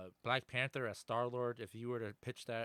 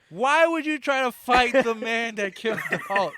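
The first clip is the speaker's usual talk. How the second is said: high, very loud comic outburst; mock incredulous shout; 12 semitones higher, 17 dB louder than usual. Heckling the men who punch Wolverine, high incredulous shouting. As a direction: yell it in hammed-up disbelief. Acting incredulous at bar brawlers.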